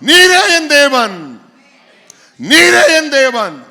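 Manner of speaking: slow, loud, drawn-out cry to God; loud prayerful cry, with a laugh breaking in. Retold or imitated. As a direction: call out to God with longing. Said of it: "நான் கதறி கூப்பிடுற கத்தம்".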